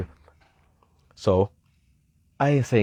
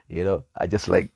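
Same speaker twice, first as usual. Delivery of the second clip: ordinary talk, nothing marked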